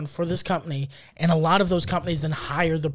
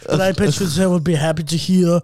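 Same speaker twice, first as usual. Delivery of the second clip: cheery mock German counsellor; put-on German-accented English, amused; homely German accent. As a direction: console them in a comic German accent. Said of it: "Yours sounds like you're gonna make me something to eat".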